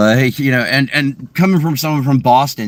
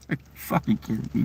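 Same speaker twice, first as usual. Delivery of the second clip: very soft, fast, voiceless aside; muttered disbelief; 3 semitones lower, 11 dB quieter, faster than usual. Retold or imitated. Retelling a reaction to the mangled name, under the breath.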